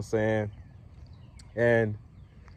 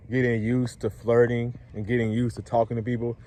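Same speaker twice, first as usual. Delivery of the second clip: normal talking voice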